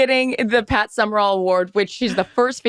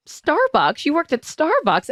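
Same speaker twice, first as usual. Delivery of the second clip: surprised dad; 9 semitones higher, slower than usual. Retold or imitated. Dad reading bio, surprised.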